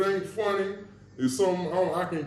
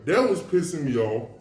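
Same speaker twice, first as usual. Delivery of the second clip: ordinary talk, nothing marked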